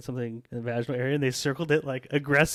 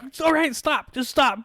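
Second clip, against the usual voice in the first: much higher, louder begging to stop; exasperated plea; 14 semitones higher, 5 dB louder than usual. Retold or imitated. Medic begging the chart writer to stop, much higher and louder. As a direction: plead with them to stop describing. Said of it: "You're like".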